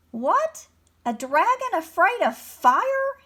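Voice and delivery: high, loud scornful snake; hissing snake. A narrator's reading. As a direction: hiss out the disbelief. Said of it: "hissed a snake".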